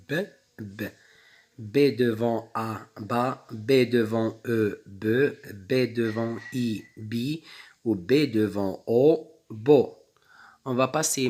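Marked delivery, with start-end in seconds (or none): 1.6-9.8 steady classroom sounding out, each syllable set apart; syllable drill. Shown as a demonstration. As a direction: drill the syllables for young pupils. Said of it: "teacher sounds out B syllables".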